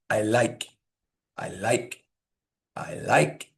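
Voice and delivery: LIKE lifted in a rising glide. Shown as a demonstration. Teacher drilling the word, rising stress on "like". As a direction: swoop up on "like" each time. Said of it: "normally it's a higher pitch".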